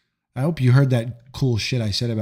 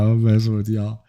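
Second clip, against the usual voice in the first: ordinary talk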